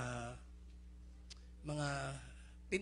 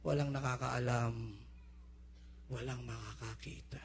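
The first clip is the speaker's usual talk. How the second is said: voicing Satan; 6 semitones lower, 3 dB quieter than usual. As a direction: whisper the devil's lure. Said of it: "sasabihin ni Satanas". (mahina at mababang boses ni Satanas)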